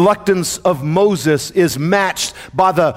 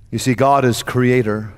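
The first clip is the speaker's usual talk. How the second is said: usual speaking voice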